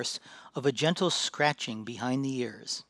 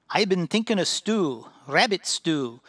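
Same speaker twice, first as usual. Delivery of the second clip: loud, higher, eager dwarf musing on stew; hungry dwarf; 5 semitones higher, 6 dB louder than usual. Acted. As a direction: savour the thought of rabbit stew. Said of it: "a hungry sparkle lighting his eyes".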